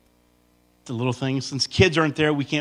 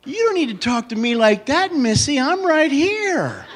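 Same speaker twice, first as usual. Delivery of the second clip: mock husband protesting; 12 semitones higher, much slower than usual. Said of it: "I'm like".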